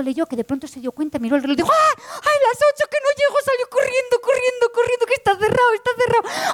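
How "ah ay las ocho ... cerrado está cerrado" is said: much higher, breathless hurry; panicked rush. Acted. Woman running late to the office, high and panicked. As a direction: race the clock in a high panic. Realises she's late, runs.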